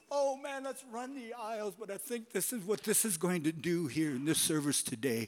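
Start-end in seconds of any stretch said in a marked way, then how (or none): 0.1-1.8 high, hyped-up call to celebrate; mock excited preaching. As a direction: play the shouting preacher you wish to be. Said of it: "I wish I could just go".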